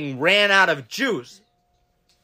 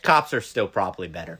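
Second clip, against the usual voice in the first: low deadpan; 11 semitones lower than usual. Retold or imitated. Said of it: "And I go".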